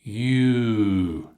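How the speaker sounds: "You" held long with rounded lips. Shown as a demonstration. Teacher repeating "You" so learners see the lips. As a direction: stretch out "You" slowly. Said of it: "look at the mouth difference".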